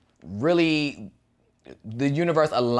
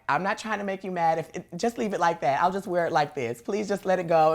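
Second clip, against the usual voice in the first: pleading; 6 semitones higher than usual. Retold or imitated. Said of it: "And I'm like".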